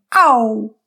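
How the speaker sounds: a sharp, loud "Auw!" of sudden pain; Eurydice's cry of pain. Acted. Eurydice stubs her foot on a rock: sharp loud cry of pain. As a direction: yelp as if you stubbed your foot.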